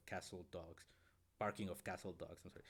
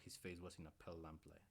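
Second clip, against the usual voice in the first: ordinary delivery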